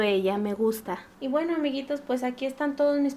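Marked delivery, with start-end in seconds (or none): none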